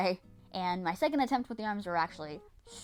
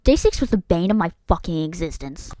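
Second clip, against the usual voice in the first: booming "day six" like a movie trailer; loud, theatrical proclamation of doom; big melodramatic announcer voice; dramatic announcement; 3 semitones lower, 13 dB louder than usual. Pretend.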